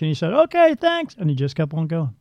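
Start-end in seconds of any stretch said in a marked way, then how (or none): 0.3-1.1 high, loud breezy thanks; passing spirit. Retold or imitated. Spirit running past her, breezy high thanks. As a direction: toss off a quick high thank-you over the shoulder.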